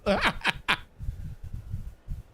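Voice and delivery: short breathy chuckle; light, real chuckle. Real, not acted. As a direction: laugh in quick bursts, trailing off. Amused at the stream's opening.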